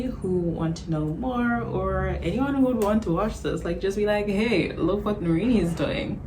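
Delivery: ordinary talk, nothing marked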